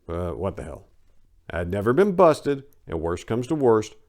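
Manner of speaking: ordinary talk, nothing marked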